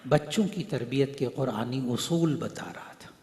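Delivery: normal talking voice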